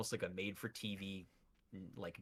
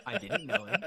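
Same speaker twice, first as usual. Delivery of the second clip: high, loud, laughing protest; cracking up while disowning someone; laughing denial; 9 semitones higher, 8 dB louder than usual. Real, not acted. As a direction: protest through real laughter.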